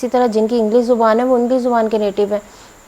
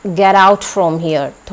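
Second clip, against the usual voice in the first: very slow, louder angry order; mock angry command; 5 dB louder, much slower than usual. Shown as a demonstration. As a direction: drag out each word in anger. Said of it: "गुस्से का expression आना चाहिए".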